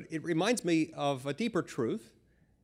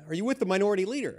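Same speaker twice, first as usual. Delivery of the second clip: imitated guard, louder, higher and quick; insistent challenge; 8 semitones higher, 6 dB louder, faster than usual. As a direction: press the question fast and loud. Guard repeating his challenge, retold.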